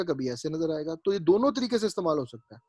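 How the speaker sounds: normal talking voice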